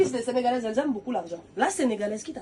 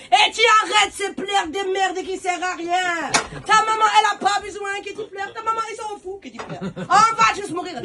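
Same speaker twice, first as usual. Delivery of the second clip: higher, louder, slow shouting; scolding auntie; 9 semitones higher, 8 dB louder than usual. Retold or imitated. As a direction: yell at her to stop crying. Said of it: "Elle me dit".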